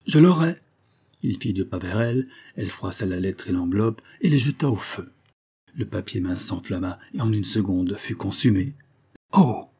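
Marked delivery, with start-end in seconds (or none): 0.1-0.6 loud, high, forceful vow; angry insistence. Acted. Husband set on seizing his wife's letter, angry. 9.3-9.7 loud, high gasp of shock; aghast exclamation. Acted. Husband watching the letter burn, aghast. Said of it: "béant".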